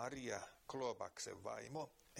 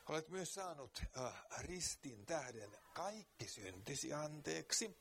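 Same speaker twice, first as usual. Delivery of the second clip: earnest preaching; 3 semitones higher than usual. Real, not acted. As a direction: press the point home to the listener. Preaching continues. (kiihkeä saarnaaminen)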